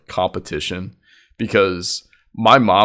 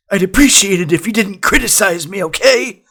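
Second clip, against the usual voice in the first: high, louder put-on voice; mock indignant retort; 13 semitones higher, 6 dB louder than usual. Cousin objects to grandma's criticism, high indignant voice. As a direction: imitate a cousin protesting, pitched up.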